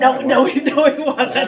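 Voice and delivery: giggling no, no to the teasing; laughing denial. Real, not acted. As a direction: protest while cracking up.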